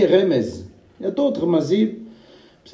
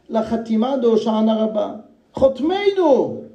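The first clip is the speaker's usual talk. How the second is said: high, rising preacher's chant on the sealing of judgment; the rabbi builds to a fervent climax for his listeners, preaching high; 10 semitones higher than usual. Acted. Rising fervour quoting the Zohar.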